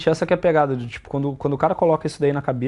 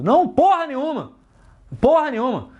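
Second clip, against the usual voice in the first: emphatic rejection; 11 semitones higher than usual. Real, not acted. Rejecting the alpha-voice myth.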